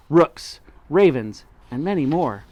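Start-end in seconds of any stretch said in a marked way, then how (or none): none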